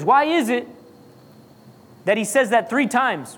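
ordinary talk, nothing marked

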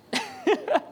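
a bright high burst of laughter. Real, not acted. Preacher laughing at his own joke, high laugh.

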